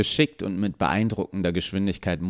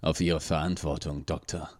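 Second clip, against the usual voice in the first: a touch of a low grumbling growl; 3 semitones lower, 4 dB quieter than usual. Acted. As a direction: mildly growl it, a tense policeman. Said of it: "und knurrte".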